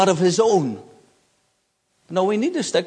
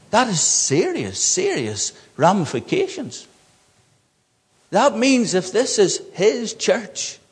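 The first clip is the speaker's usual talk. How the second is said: normal talking voice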